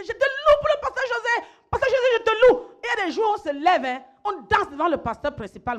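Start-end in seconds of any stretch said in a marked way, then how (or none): 0.0-1.5 high, loud chant of praise; exaggerated, sustained high-pitched praise; acted praise shout. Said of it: "quelqu'un qui dit". 1.7-2.7 high, loud cry of praise; exaggerated, high-pitched acclaim; acted praise shout. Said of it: "quelqu'un qui dit".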